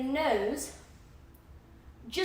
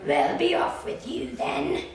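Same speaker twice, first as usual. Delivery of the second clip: slow, rough troll dismissal; troll sending the little goat away; grumbling troll; 3 semitones higher than usual. Acted. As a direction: wave the goat off grudgingly. Troll lets goat pass.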